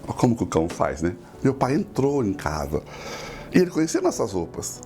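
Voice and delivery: usual speaking voice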